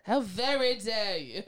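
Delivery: loud, slow hammed-up British accent. Shown as a demonstration. Co-host topping John's attempt, exaggerated British accent. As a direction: play mock-indignant British English, drawn out. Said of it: "you got a British accent?".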